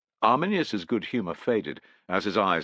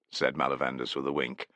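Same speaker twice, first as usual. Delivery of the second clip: ordinary delivery, nothing marked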